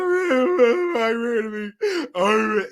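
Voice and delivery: mock wailing, words dragged out; hammed-up blubbering, stretched slow; big ugly cry. Retelling their panic at pregnancy news, mock ugly cry. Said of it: "I got that big ugly cry".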